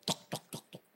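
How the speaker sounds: soft unvoiced knock-knock; knocking sound. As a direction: tap out a knock with the voice. Knock at the door.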